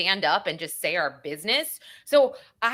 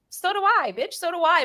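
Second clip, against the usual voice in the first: sassy retort; 11 semitones higher than usual. Retold or imitated. Mock retort to other jurors.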